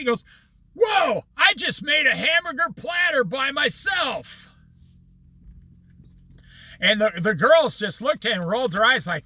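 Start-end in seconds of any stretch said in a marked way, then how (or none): 0.7-4.5 higher-pitched imitation of a thrilled hippie; mock amazed boast. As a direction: marvel at yourself, playing the proud beginner. Hippie amazed at his own achievement.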